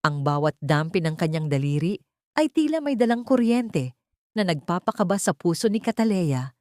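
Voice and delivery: ordinary delivery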